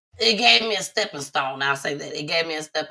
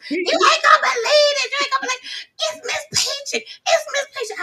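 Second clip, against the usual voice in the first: high screamed imitation in a New Orleans accent; 4 dB louder, faster than usual. Guest phoning her husband about the host, imitated New Orleans accent scream. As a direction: imitate an excited fan's New Orleans squeal. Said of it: "she got that extra accent".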